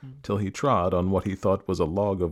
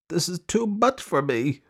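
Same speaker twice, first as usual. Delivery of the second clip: high-pitched pinched-nose complaint; through the nose; 9 semitones higher than usual. Acted. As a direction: whine it with the nose held shut. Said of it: "he said through his nose".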